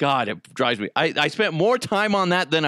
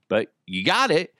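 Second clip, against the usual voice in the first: ordinary talk, nothing marked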